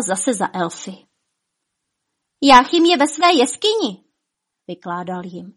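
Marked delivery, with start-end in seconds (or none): 2.4-4.0 urgent report. A narrator's reading. Dwarf hurrying to report to elves.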